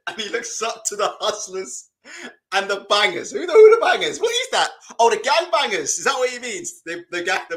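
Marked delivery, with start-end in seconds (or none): none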